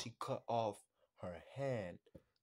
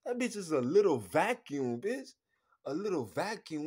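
much louder, rising in a squeal; loud mock praise; 4 semitones higher, 11 dB louder than usual. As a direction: shout it like a wild compliment.

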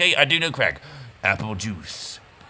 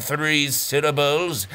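ordinary talk

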